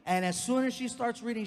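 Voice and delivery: ordinary delivery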